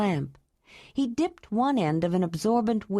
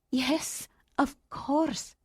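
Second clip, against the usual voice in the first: higher, soft woman's voice; 7 semitones higher, 3 dB quieter, much slower than usual. A narrator's reading. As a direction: answer gently as the woman. Ayla answers, higher.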